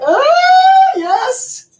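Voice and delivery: high squeal of delight, ah yes. Real, not acted. Mom squealing yes to her son's bike ride offer. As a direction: shriek it out like a thrilled mom.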